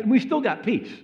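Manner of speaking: loud, high burst of insistence; emphatic exclamation. Real, not acted. Preacher on praying despite a warning of death, emphatic. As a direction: raise the voice high and hard on the punchline.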